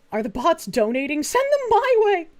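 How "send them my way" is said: much higher, playful and louder. Real, not acted. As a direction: toss it off in a bright squeak. Joking about bot donations.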